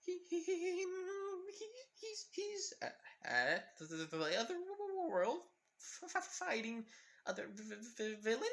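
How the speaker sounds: high, stuttering frightened whimper; whimpering stammer. Acted. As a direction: stammer it out in fear. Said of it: "Karin just whimpering".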